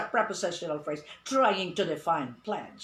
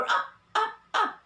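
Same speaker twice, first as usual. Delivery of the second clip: high, repeated "Up" called out a little louder; mock sing-song command; 3 dB louder than usual. Shown as a demonstration. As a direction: chirp it like urging a child up. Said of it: "In English, we do not say".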